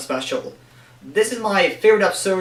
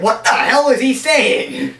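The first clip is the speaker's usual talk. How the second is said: slow, higher, louder mock bafflement; baffled villain; 6 semitones higher, 5 dB louder, slower than usual. Villains baffled by Spider-Man's speech, slow mock disbelief. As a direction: drag it out like a confused thug. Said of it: "they're like".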